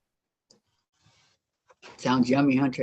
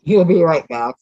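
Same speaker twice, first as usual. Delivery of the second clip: talking with a mouthful; words round a bite of cookie; mouth full; 9 dB louder than usual. Real, not acted. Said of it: "talking with food in her mouth".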